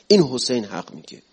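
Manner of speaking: usual speaking voice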